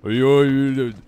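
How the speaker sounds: loud movie-hero calling out; action movie voice. Pretend. Streamer doing a movie impression calling Adrian, loud bellow. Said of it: "This is the action voice movie".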